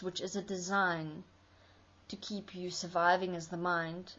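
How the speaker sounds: ordinary talk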